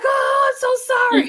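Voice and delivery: put-on high, loud, wailing apology of a crying boy; crying kid apology. Twin who hurt his brother wails sorry in mock sobs. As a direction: wail the sorry like a weeping little boy.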